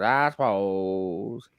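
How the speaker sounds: put-on very slow, slurred old local; mumbling old man. Host imitates the old guy from Hot Fuzz, slow mumble. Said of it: "the old guy you can't understand".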